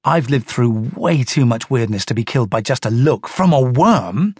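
usual speaking voice

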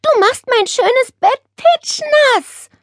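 high, drawn-out indignant complaint; scolding. A narrator's reading. Nele scolding the parrot on her bed, indignant. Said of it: "schimpfte Nele".

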